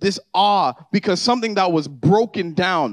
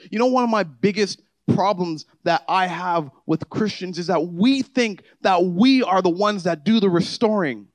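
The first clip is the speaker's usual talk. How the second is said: louder and higher, driving sermon climax; preaching at full force; 6 semitones higher, 4 dB louder than usual. Acted. Pastor urging the congregation about transformation, fervent preaching. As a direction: build it up and lift the room.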